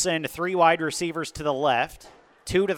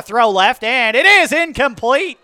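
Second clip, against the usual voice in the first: louder, higher rising pass call; excited play-by-play; 7 semitones higher, 10 dB louder than usual. Real, not acted. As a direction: build the excitement on the throw. Incompletion called live.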